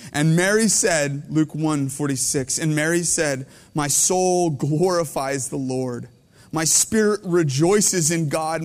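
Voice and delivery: slow, solemn reading of the verse; scripture reading. Acted. Preacher reading Luke to the congregation, slow and solemn. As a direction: read Mary's song with reverence.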